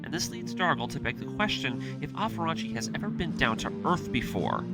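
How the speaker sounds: ordinary delivery, nothing marked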